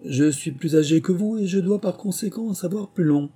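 put-on high, sulky old-bird voice; sulky lory. A narrator's reading. Lory sulking at Alice, high and pouty. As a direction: pout the words out like a sulky parrot. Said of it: "lui dit d'un air boudeur".

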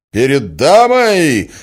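high, loud, drawn-out incredulous shout; outraged cry. A narrator's reading. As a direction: throw her words back in fury. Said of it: "вскричал Мозгляков". (возмущённый крик)

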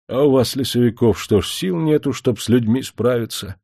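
plain reading voice

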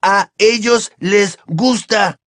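Ron's voice. A narrator's reading. Ron answers Hermione in a light boyish voice. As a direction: play the cheeky teenage boy.

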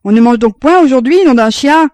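voix forte et haute, agacé; crié dans l'escalier. A narrator's reading. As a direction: crier depuis l'escalier, impatient. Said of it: "qui criait dans l'escalier". (père affamé criant dans l'escalier, fort)

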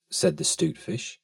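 usual speaking voice

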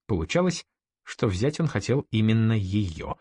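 ordinary delivery

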